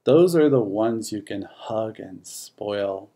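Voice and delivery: ordinary delivery, nothing marked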